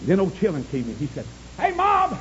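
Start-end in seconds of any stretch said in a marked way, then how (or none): 1.6-2.2 high-pitched holler; high hailing call; calling out to mom. Retold or imitated. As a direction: call out high like an excited son. Son calls to his mother.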